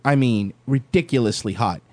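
normal talking voice

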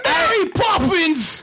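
squealed high exclamation; high shout. Acted. Riffing on bad Cockney accent.

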